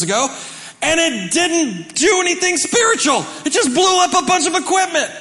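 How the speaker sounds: high, loud comic preaching; preaching at full force. Acted. Pastor to congregation, loud preaching punchline. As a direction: push it to the top, then land the joke.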